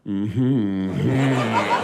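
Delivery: low, loud drawn-out mm-hmm; wordless agreement hum. Retold or imitated. American avoiding speech beside British guy, low humming agreement. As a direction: hum agreement deep and slow.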